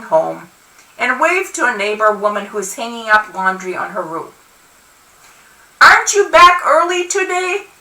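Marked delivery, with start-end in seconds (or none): 5.8-7.7 high-pitched neighborly call from above; yelling down. A narrator's reading. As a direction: call it down to someone below. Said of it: "she yells down at me".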